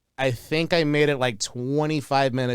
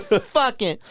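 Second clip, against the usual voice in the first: put-on drunk voice; 9 semitones higher than usual. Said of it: "that drunk voice is pretty spot on for you".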